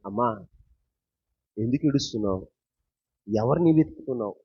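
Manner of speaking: gentle Jesus. Acted.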